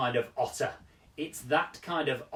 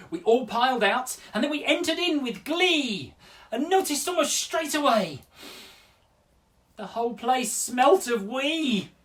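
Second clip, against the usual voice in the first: lively, high, hammed-up poem recital; performed poem; 7 semitones higher, 5 dB louder than usual. Poet performing a funny zoo poem to children, lively and high. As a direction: perform it big for the kids. Put my performing hat back on.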